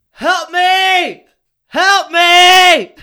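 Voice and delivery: high, loud panicked scream; screaming for help. Acted. Keith strapped to the table, shrieking high for help. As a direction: shriek it like you're strapped down and desperate.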